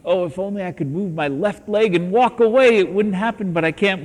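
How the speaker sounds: loud, high, straining cry of someone frozen in a nightmare; a trapped dreamer crying out in half-acted panic; panicked dreamer. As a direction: plead desperately to move your leg. Dreamer stuck, unable to move.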